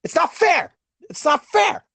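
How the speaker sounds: mock Bill Burr rant, a touch whiny; a hint of Bill Burr griping; Bill Burr impression. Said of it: "my Bill Burr impression".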